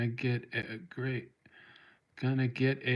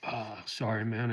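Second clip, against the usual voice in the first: choked up; 3 semitones lower, 5 dB quieter than usual. Real, not acted. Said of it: "I get a little choked up".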